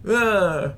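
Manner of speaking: put-on high, loud frustrated baby "ah"; babyish wail. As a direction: let out a tantrum cry like a big baby. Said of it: "Like a big baby".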